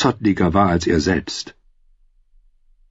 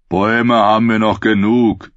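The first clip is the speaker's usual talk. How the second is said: another rough bandit's character voice; gloating henchman; menacing outlaw; 2 semitones higher, 3 dB louder than usual. Acted. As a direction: chime in with a threat.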